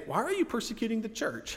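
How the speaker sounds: "Why are you persecuting the church?" lifted high, as the line Jesus never said; "the church" asked high as the version to set aside; the wrong version. Shown as a demonstration. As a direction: pitch the rejected wording up. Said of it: "Jesus doesn't say".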